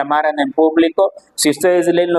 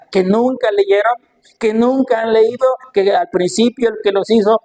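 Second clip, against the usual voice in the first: higher-pitched incredulous questions; challenging rebuke; 6 semitones higher than usual. Acted. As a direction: challenge them on what they should know. Jesus challenging his questioners.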